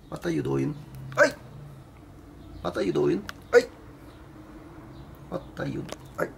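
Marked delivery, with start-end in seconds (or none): none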